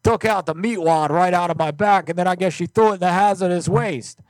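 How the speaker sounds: high, loud, pestering caller voice; loud prank caller. Acted. Prank caller pleading for a lost meat wad, high and loud.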